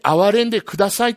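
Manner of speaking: loud, high, fast plea; crying for mercy. Retold or imitated. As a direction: cry out desperately for mercy. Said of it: "と叫んだでしょ".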